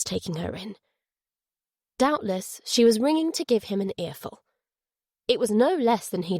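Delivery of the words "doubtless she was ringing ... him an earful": ordinary delivery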